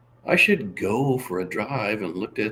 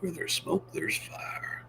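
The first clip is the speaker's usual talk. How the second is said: dramatic whisper; 7 semitones higher, 11 dB quieter, slower than usual. Acted. Dramatic line.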